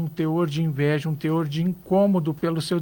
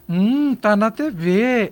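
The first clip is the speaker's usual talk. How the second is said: slow, louder sneering "Hum, tá na T V"; envious sneer; 3 semitones higher, 5 dB louder, slower than usual. Shown as a demonstration. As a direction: drag out "Hum" with a falling, begrudging tone. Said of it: "Olha a entonação da minha voz".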